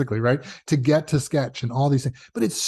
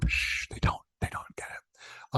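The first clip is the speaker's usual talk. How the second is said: hushed aside; 10 dB quieter than usual. Real, not acted. Whispering to Barbara so censors won't hear. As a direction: drop to a whisper about the censors.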